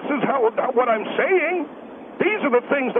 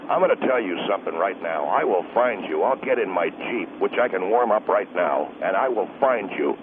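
low, gruff angry husband. Acted. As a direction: growl it like a protective husband. Husband threatens caller.

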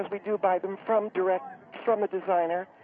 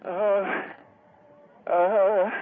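long, loud "uh" exhalations; hammed-up recovery breathing; breathing exercise; 4 dB louder than usual. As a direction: heave out each breath noisily. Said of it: "These are breathing exercises you gotta do".

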